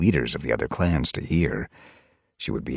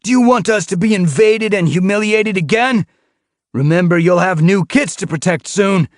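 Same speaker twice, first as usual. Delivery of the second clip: much higher, louder challenging shout; demanding; 9 dB louder than usual. A narrator's reading. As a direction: throw the question at his father. Continues demanding.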